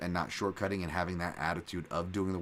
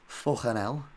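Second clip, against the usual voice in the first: put-on Liverpool exclamation; Scouse accent; 3 semitones higher, slower than usual. Host having another go for a viewer, amateur Scouse accent. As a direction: try it in throaty Scouse, rising at the end. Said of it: "some more Scouse accent".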